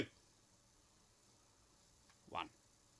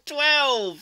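loud triumphant shout of Twelve; excited shout; 10 semitones higher, 11 dB louder than usual. Real, not acted. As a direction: yell Twelve in celebration. Counting, the record broken.

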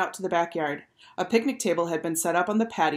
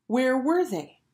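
raised, worried wondering; higher-pitched anxious question; 7 semitones higher, slower than usual. A narrator's reading. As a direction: ask it higher, wondering where they are. Worried wait for late boys.

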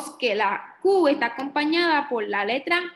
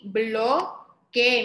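"bloque" in two beats, BLO... KE; syllable by syllable; 2 semitones lower than usual. Shown as a demonstration. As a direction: pause inside "bloque" before KE. Teacher sounds out word by syllables.

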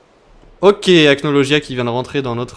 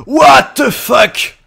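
slow, much louder, higher shouted disbelief; stretched-out shocked yell; 11 semitones higher, 10 dB louder, much slower than usual. Real, not acted. Reaction to frogs.

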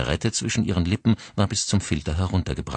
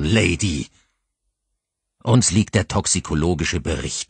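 mounting anger; 3 semitones higher, 6 dB louder than usual. Acted. Father confronting the driver with the report, furious. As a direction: drive each fact home angrily. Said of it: "in noch größerem Zorn".

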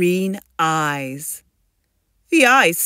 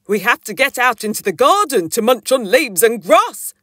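louder, brisk, higher storybook dad; explaining dad character; 5 semitones higher, 7 dB louder, faster than usual. Acted. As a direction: rattle off the plan to a child.

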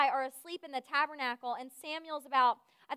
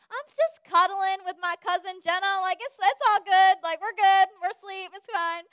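louder, hasty excuse; mock defensive; 4 semitones higher, 9 dB louder than usual. Caught counselor excusing herself to her boss, mock defensive. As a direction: talk your way out of trouble, loudly.

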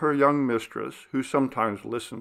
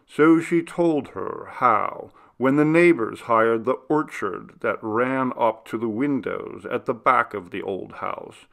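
ordinary delivery

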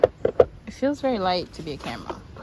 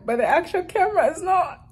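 rising high, then breaking into laughter; near-tears laughter; 10 semitones higher than usual. Real, not acted. Admitting to viewers the camera is gone, near-tears laughter. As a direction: let the last word collapse into a helpless laugh. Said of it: "I don't know why I'm laughing".

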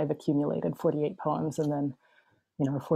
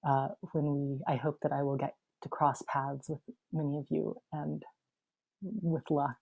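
ordinary delivery

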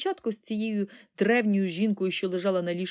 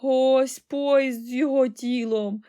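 loud, high, slow lament; drawn-out, hammed-up grief; sobbing old woman; 5 semitones higher, 6 dB louder, slower than usual. A narrator's reading. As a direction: wail it out mournfully. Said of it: "ридала вона".

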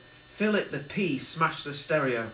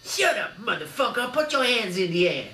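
loud, high tough-guy bark; menacing gangster shout at the party; gangster thug; 7 semitones higher, 4 dB louder than usual. A narrator's reading. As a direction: threaten them all. Gangster bursts in.

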